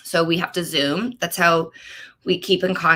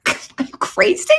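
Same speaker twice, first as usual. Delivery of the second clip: breathy high laughing question; laughing disbelief; 11 semitones higher than usual. Real, not acted. Answering husband's question, laughing high. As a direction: laugh it out incredulously.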